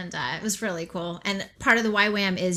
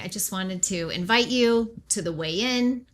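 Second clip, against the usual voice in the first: ordinary talk, nothing marked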